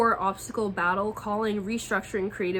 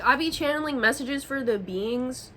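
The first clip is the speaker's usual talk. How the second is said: usual speaking voice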